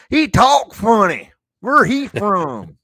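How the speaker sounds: put-on Southern accent. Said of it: "And I can talk Southern".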